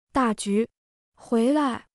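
restrained narrated shout; calling out. A narrator's reading. Chunya calls her cat back from the roof, mild narrated shout. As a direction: call the cat back with drawn-out words, without full volume. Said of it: "春丫立刻大喊一声".

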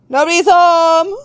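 high, loud singsong call; playful high call-out; mock calling out. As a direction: sing it out to the door, rising at the end. Calls out in answer to knocking.

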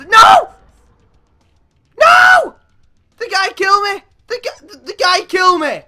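loud, high, outraged screams; screaming. Real, not acted. Gamer killed just before winning, screaming in outrage. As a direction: scream it in disbelief. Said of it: "a lot of screaming, shouting".

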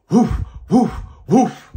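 high, loud woofs; dog barking. A narrator's reading. Reading to kids, barking like his dog. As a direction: bark like a big happy dog. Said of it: "My dog barks".

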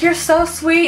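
high, sweet gushing; imitating a touched fan favorite; Jacqueline. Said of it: "And she's like".

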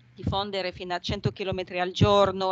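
ordinary talk

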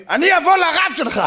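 high, loud mock-outraged yell; shouted threat. Angry man threatens to go to the speaker's rabbi, mock shouting. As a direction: threaten at the top of your voice.

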